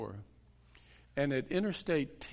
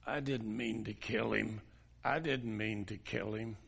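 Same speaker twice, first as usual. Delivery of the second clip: possessed voice; 3 semitones lower, 3 dB quieter than usual. Retold or imitated. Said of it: "the voice came and said".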